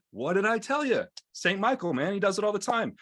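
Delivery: high-pitched, emphatic mock boast; told-you-so assurance. Medic to patient worried about demons, acted told-you-so reassurance. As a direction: insist on it as if proven right.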